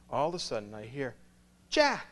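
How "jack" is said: calling out. Retold or imitated. Someone calls out to him.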